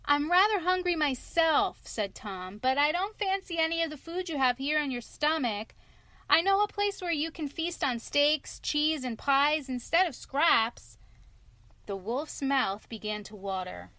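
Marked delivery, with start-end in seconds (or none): 0.0-11.0 put-on high, bright boy hero; small clever boy talking up to a wolf; little boy voice. Tom Thumb's small boy voice.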